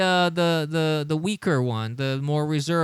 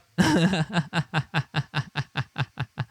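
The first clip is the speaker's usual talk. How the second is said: long real chuckle; laughing. Real, not acted. Streamer chuckling at a reply calling him Fredo.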